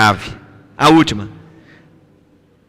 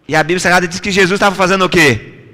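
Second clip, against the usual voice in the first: usual speaking voice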